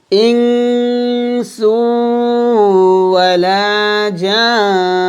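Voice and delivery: tajweed demo with ghunna only; ghunna recitation demo. Shown as a demonstration. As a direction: chant it and stop on the nun. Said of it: "যেমন এরকম".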